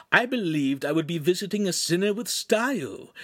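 high-pitched, grandiose scorn; mocking, pompous tirade; theatrical demon imp. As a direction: declaim like a ham tragedian, building through the list.

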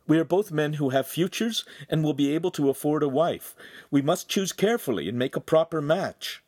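put-on placating young man, emphatic; reasoning brother. A narrator's reading. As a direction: reason firmly with an angry brother. Voices Handsome reasoning with brother.

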